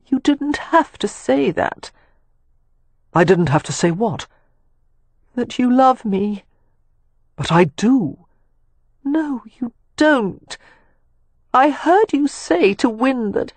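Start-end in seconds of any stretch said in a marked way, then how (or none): 0.1-1.9 put-on high, slow and wounded young woman; Catherine's high hurt voice. A narrator's reading. To Leo on the roof, a high, slow, hurt reproach. Catherine challenges Leo's words. 5.3-13.5 put-on high, slow young woman, stubborn and wounded; Catherine, hurt. A narrator's reading. To Leo, whom she overheard, a high hurt accusation.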